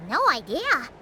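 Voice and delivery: ordinary delivery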